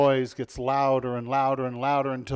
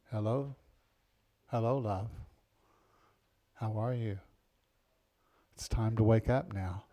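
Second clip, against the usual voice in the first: gentle wake-up call; 4 semitones lower, 3 dB quieter than usual. Retold or imitated. Tender partner waking you gently.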